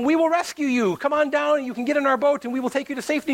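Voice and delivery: high-pitched rescuer's call, acted. Rescuers call up to stranded man.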